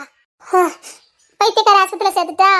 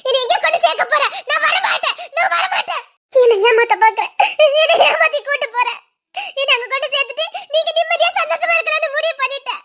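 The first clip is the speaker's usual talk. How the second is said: high manic laughter through the words; hysterical laughing accusation; 5 semitones higher than usual. Acted. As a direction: accuse him, laughing out of control. (கணவனிடம் பித்துப்பிடித்த சிரிப்புடன் குற்றச்சாட்டு)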